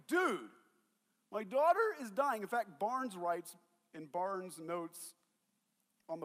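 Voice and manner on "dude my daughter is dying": higher, louder, urgent "dude"; urgent plea. Retold or imitated. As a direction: pull at his sleeve, hurry him. Jairus urging Jesus casually.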